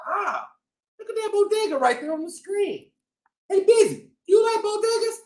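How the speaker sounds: lively shopkeeper cat telling his story; boastful bodega-boss cat, upbeat; cat narrator Chip. Acted.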